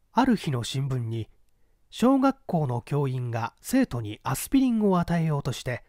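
plain reading voice

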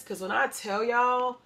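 ordinary talk, nothing marked

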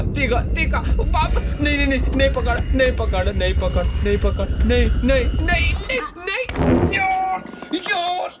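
high, frantic no no no as he gets caught; panicked cries. Real, not acted. Monster grabbing him, protests.

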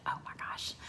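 whispered, soft stunned reaction; hushed shock. Retold or imitated. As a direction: whisper it in mild disbelief. Acting out her stunned reaction.

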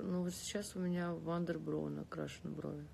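ordinary talk, nothing marked

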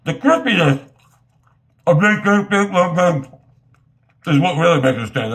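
food in mouth; slightly muffled chewy speech; talking while chewing. Real, not acted. Seen 0: Bites pizza at -2s, then talks holding slice near mouth.

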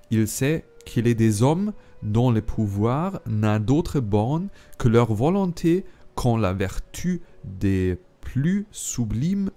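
careful, slightly slowed French read with a German speaker's pronunciation; earnest French recitation by a German reader; reading French aloud. Shown as a demonstration. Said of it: "ich lese es einmal auf Französisch vor".

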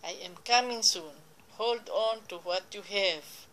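ordinary delivery

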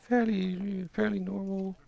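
high, tentative put-on voice. Shown as a demonstration. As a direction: lift "fairly normal" up high. Trying out the high voice.

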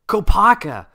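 high, loud cry of a name; excited exclamation. A narrator's reading. Jaller spots the Toa of Ice and cries out in amazement. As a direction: call out the name in sudden amazement. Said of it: "Jaller exclaimed".

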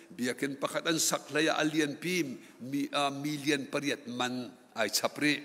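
ordinary talk, nothing marked

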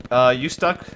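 ordinary talk

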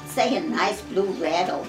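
ordinary delivery, nothing marked

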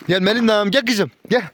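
high sing-song call to come; calling the dogs. Real, not acted. (köpekleri yüksek sesle çağırma)

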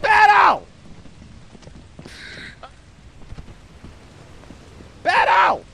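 high loud call across the map. Real, not acted. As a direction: call the lost friend's name. Calling lost teammate.

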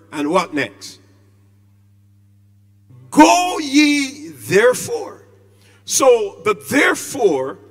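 loud, ringing pulpit delivery, drawing out authority; preaching at full force. Acted. Preacher to his congregation on Christ's authority, booming. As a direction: proclaim it to the whole church. Preacher proclaims the Great Commission.

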